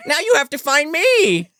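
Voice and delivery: put-on high, loud, taunting child voice; Bobby. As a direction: gloat as a creepy little boy. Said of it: "turns into Bobby's voice and is like".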